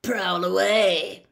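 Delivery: loud, drawn-out growl; full of menace. A narrator's reading. Ex-Auror daring the teacher to prowl, full of menace. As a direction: stretch it out menacingly. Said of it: "his voice was full of menace".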